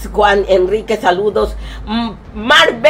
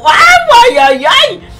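excited squeal; 13 semitones higher, 6 dB louder, slower than usual. Real, not acted. Excited cry at the idea.

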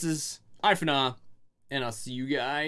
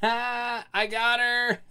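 laughing; 8 semitones higher, 3 dB louder, much slower than usual. Real, not acted. Laughing after tricking her.

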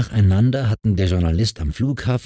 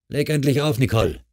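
raised-pitch firm order; commanding; 7 semitones higher than usual. A narrator's reading. Unseen man orders the wife to hang up, commanding. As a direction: order her off the phone. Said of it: "befahl die Stimme".

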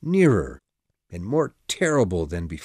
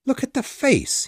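high, excited shout; 3 dB louder than usual. A narrator's reading. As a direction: burst out in surprise. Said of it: "he shouted".

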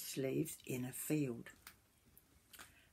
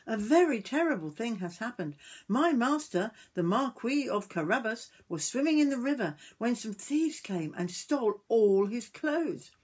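higher, louder mock-distressed pleading; dramatic Puss; 7 semitones higher, 5 dB louder than usual. A narrator's reading. Puss tells the king of the theft, hammed-up dismay. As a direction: ham up the terrible news for the king. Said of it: "said Puss, bowing low".

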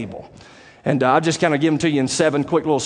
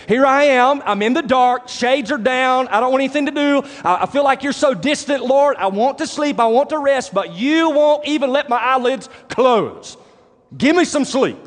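higher-pitched acted lament to God; modernized psalmist's complaint; 7 semitones higher than usual. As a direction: plead like a worn-out man denied his sleep. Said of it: "modernizing this".

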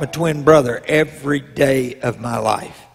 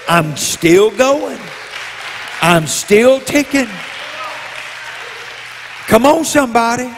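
full-force preaching; 4 semitones higher than usual. Acted. Preacher urging the church to shout back, full-force preaching. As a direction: whip up the congregation.

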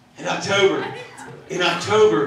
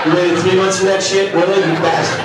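louder indignant retort; mock outrage; 2 semitones higher, 5 dB louder than usual. Husband scolding his wife for her prank in mock outrage.

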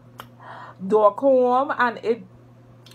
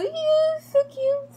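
high cooing; slower than usual. Real, not acted. Cooing over camera.

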